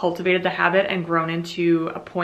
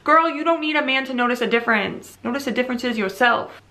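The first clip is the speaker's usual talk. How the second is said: sassy; 6 semitones higher than usual. Acted. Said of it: "Present me talking to past me".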